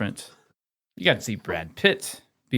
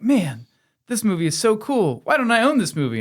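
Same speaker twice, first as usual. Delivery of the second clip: high-pitched, awed fan; rising excitement, pitched way up; acted enthusiasm; 11 semitones higher, 3 dB louder than usual. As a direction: gush as if discovering a favourite. Acts out excited first-time viewer.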